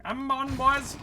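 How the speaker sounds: high call to the troops; rallying shout. Real, not acted. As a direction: cheer the team on, up high. Rallying allies in a fight.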